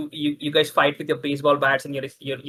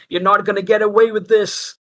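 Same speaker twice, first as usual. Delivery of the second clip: loud, raised villain's parting shout; shouted threat; 7 semitones higher, 7 dB louder than usual. Acted. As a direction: shout it after them as you flee. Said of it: "Stacy just shouts".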